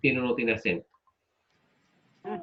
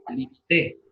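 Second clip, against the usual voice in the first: "limité" said li-mi-TÉ, stress on TÉ; stress on the last syllable; 2 semitones lower, slower than usual. Shown as a demonstration. Teacher misspeaking the next pronunciation, stress on TÉ in "limité". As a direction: land on the final syllable of "limité". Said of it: "Y la otra pronunciación sería".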